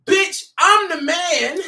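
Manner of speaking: slow, high, every word weighed; drawn-out boast. Retold or imitated. Fan repeating the lyric, slow and emphatic. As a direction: stretch it out, savor the brag.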